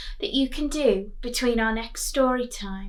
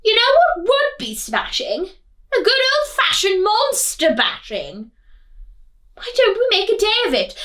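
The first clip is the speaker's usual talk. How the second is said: high, loud eager villagers; bored townsfolk; 11 semitones higher, 8 dB louder than usual. Acted. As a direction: pitch it up like excited bored townsfolk. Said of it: "They sighed".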